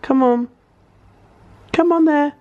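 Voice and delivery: high anxious coaxing; nervous coaxing. Real, not acted. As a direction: coax the bee out nervously. Coaxing the bee out.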